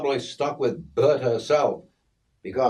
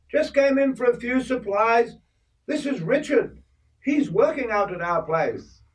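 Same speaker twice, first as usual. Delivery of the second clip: put-on much higher voice for old Kay; old Kay chiding the boy about hair oil, high voice; voicing Kay; 13 semitones higher than usual. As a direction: play the older woman fussing, pitched up. Kay introduces Richard.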